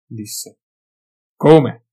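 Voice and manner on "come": short loud grunted question; gruff grunt. A narrator's reading. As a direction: bark a gruff grunt of surprise. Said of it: "grugnì Gotrek".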